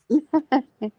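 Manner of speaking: high-pitched laugh; amused laugh. Real, not acted. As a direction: laugh while teasing a friend. Amused teasing of caller.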